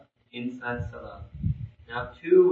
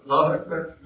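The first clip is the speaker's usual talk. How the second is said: normal talking voice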